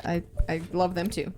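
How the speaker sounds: normal talking voice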